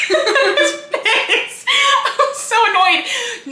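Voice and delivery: high, slow words squeezed through laughter; laughing exasperation. Real, not acted. Reader admitting the cliché annoyed her, laughing hard. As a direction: laugh helplessly while complaining.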